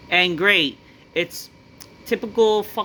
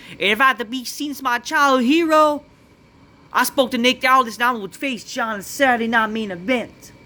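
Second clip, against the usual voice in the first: lightly raised mock R-Truth promo; imitating R-Truth; 6 semitones higher than usual. Vlogger retelling R-Truth's promo, lightly imitated. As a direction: rattle off his promo a bit higher. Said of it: "He said".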